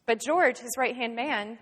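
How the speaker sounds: ordinary talk, nothing marked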